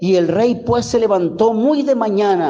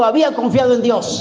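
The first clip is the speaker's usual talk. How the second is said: había confiado en Dios said quicker, as a call for the church to echo; repeat after me; 4 semitones higher, faster than usual. Shown as a demonstration. Preacher leading the congregation to repeat the verse, brisk call. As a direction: lead the phrase briskly so they can answer. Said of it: "Repite conmigo".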